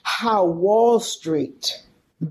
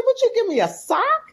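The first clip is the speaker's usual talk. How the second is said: puzzled father. Retold or imitated. Father guesses the object.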